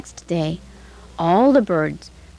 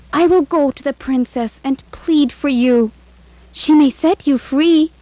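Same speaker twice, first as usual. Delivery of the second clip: kind maid; 7 semitones higher, 4 dB louder than usual. A narrator's reading. Maid speaks to birds.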